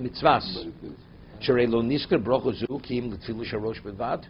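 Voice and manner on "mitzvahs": mitzvahs with an open a sound; right a sound. Shown as a demonstration. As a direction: say mitzvahs as mitz-vas. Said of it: "It's not".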